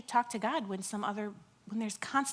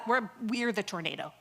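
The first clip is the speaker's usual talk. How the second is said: usual speaking voice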